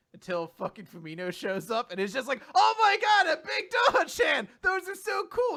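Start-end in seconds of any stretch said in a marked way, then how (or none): 2.5-5.5 much higher, squealing shout; excited squeal. Retold or imitated. Retelling Fumino spotting the plushie, high excited shout. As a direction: gush like a fan seeing her idol's merch.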